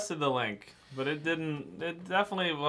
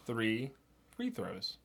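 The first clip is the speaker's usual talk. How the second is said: softer, quicker Three free throws; quicker, softer tongue twister; 3 semitones lower, 9 dB quieter, slower than usual. Shown as a demonstration. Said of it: "Pick one of those on that list".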